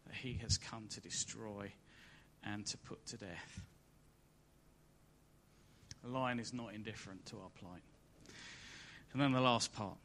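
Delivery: ordinary delivery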